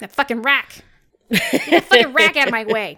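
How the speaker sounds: loud cursing at a rock; angry. Pretend. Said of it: "rock versus stone bit".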